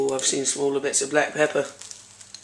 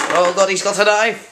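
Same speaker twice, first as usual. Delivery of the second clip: higher-pitched pretend panic; hammed-up cry of alarm; mock alarm; 5 semitones higher, 4 dB louder than usual. Friend reacts to host getting a knife, mock alarm.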